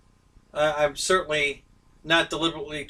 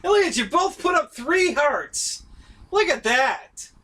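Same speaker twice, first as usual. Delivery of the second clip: excited; 9 semitones higher than usual. Real, not acted. Excited by three hearts.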